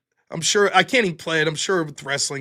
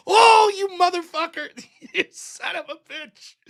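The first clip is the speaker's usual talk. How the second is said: laughing outrage; 10 semitones higher than usual. Retold or imitated. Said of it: "Everyone in that audience was like".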